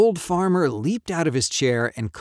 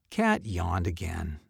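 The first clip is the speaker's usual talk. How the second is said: usual speaking voice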